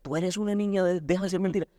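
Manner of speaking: put-on high, insistent scolding of a little girl; scolding father. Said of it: "el papá regaña a la niña".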